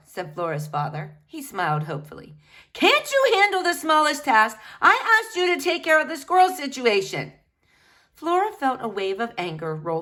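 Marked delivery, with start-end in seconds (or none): 2.7-7.3 exasperated wife. Acted. Mother berates husband.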